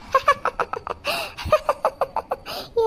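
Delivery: high gleeful cackle; evil cackle. Acted. Mother cackling after pranking her daughter. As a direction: cackle in triumph.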